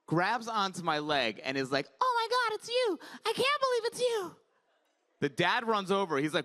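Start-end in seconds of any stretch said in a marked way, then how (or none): 2.0-4.4 put-on very high-pitched child voice; starstruck little boy. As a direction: squeal like a kid meeting a hero. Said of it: "this little boy was walking with his dad".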